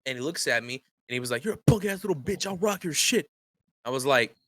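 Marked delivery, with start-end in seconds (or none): 1.4-3.3 high-pitched imitation of an aggressive customer; mock threatening. Customer looking at the manager and threatening him, mock aggressive. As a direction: taunt up high, at ordinary volume.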